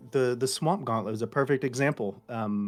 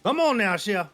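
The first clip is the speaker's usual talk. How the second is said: loud, high hammy Cajun; Cajun accent; 7 semitones higher, 9 dB louder than usual. Shown as a demonstration. Voice actor giving a requested sample, hammed-up Cajun accent. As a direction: holler in a bad Cajun accent. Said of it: "get a sample of the French accent and the Cajun accent".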